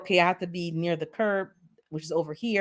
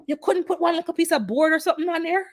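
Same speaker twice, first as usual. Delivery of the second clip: high, quick exasperated protest; exasperated complaint; 10 semitones higher, faster than usual. Retold or imitated. Pitched up, fast, complaining to the driver about the car. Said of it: "and I'm saying".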